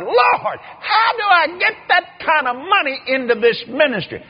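high, loud desperate plea; desperate cry to God. Retold or imitated. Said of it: "I finally said out loud".

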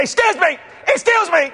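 high, pointed mock "excuse me"; confrontational tone. Angry stranger accosting the preacher off the plane, confrontational. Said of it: "you can tell by that tone".

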